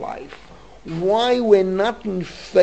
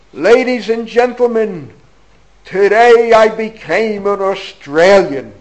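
raised, higher, like opening a speech to four hundred people; grand announcement; 4 semitones higher, 9 dB louder than usual. Retold or imitated. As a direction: proclaim it to the hall with flair. Retells opening line to big audience.

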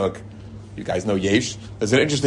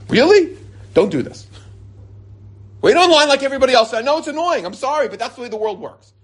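indignant scolding; 13 semitones higher than usual. Retold or imitated. Man to Mashiach-pin wearer cutting in line, indignant scolding. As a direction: lecture him with exasperated disbelief.

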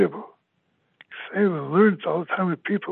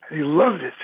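ordinary talk, nothing marked

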